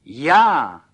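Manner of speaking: high, very loud gleeful cry. A narrator's reading. Little Pan Burgán rejoices at being the model, gleeful. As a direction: crow with delight. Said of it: "radoval se maličký pan Burgán".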